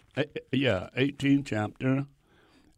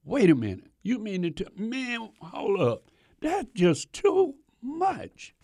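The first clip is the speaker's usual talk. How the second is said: mock disbelief; 7 semitones higher than usual. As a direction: act out a man who can't believe it. Preacher balks at seventy times seven.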